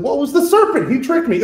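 high, whiny excuse; whiny Eve. Retold or imitated. Lecturer mocking Eve blaming the serpent, high and whiny. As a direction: pass the buck in a high petulant voice. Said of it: "She said".

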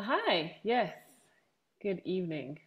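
normal talking voice

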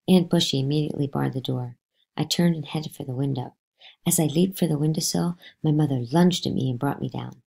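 plain reading voice

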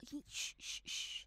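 a soft voiceless shh; shushing. Acted. Grandmother hushes the chattering women, whispered shh. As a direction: hush them at once, no voice. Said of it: "พูดเบาๆสิ".

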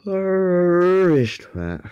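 high, slow drawn-out name call; announcer intro. Acted. Youtuber hyping up his channel name, slow and high.

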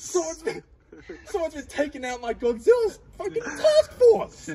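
high, shouted mock fury with laughter; hammed-up outrage. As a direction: demand who did it, way up high. Boss finds task force murdered.

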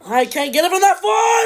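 high, loud yell at the game; frustrated shout. Real, not acted. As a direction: yell it out in disbelief.

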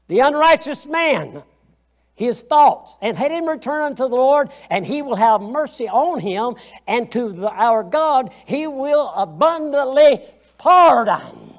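loud, ringing preacher proclamation, slowing at the end; proclaiming scripture. Acted. Preacher reading Isaiah aloud to the church, loud and slowing. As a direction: thunder out the verse and draw out the last line.

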